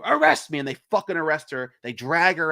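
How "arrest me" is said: much higher, shrill mock dare; defiant Laurie. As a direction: dare the cops to do it. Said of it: "I'm like, Laurie".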